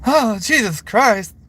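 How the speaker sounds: high, slow drawn-out exasperated voice; Mister Slave voice. Shown as a demonstration. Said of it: "I can do Mister Slave".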